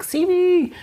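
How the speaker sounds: put-on high little-girl cry to be seen; high pleading little voice; inner child plea. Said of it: "sometimes she's like".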